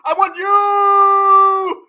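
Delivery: hammed-up yearning shout; drawn-out mock plea. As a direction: drag it out like a desperate kid. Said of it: "He said".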